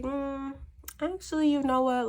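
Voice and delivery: high, put-on voice turning him down; a sassy woman waving off the offer; mock sassy refusal.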